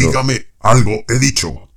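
loud, high shout. Acted. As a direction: bellow it at him. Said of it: "no tiene por qué gritar".

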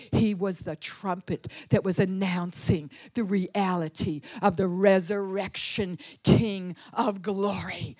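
usual speaking voice

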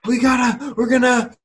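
high, rushed panicky mom voice; flustered mother losing it before a flight; panicked mom. Retold or imitated. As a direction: play the frantic mother fretting at the airport. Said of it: "She's just freaking out".

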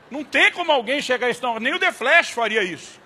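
no way anyone could do that; mock amazement; amazed disbelief. Retelling his amazed reaction.

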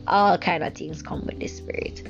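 ordinary talk

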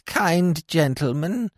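put-on high, slow, whining old crone; whining sing-song old woman. A narrator's reading. Old woman begging help from Smith, high slow whine. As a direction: wheedle like a frail beggar woman. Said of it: "she whined in a sing-song voice".

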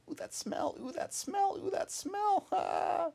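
high, rising to a shout; frantic bunny. A narrator's reading.

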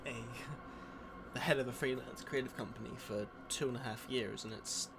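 put-on American accent, lightly done; podcast host faking an American accent; fake American accent. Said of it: "That sounds so American".

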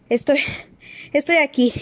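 breathless, stumbling over the words; out of breath. Acted. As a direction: gasp it out after a run. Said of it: "dijo sin aliento Harry". (sin aliento, entrecortado)

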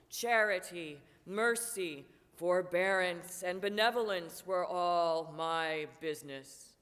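grieving ghost, loud and heavy; anguished ghost. Acted. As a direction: boom it out like a tormented spirit. Marley's ghost lamenting.

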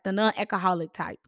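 normal talking voice

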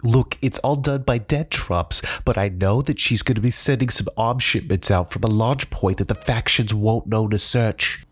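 begging for my life. Acted. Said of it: "begged for his life".

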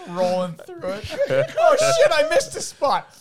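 high squealing laughter. Real, not acted. Squealing laugh mock car wash.

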